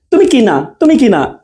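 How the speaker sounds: "তুমি কি" rushed together without a gap; short "কি" stuck onto "তুমি"; not this quick way. Shown as a demonstration. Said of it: "হ্যাঁ, দ্রুত না". ("তুমি কি" ফাঁক ছাড়া দ্রুত)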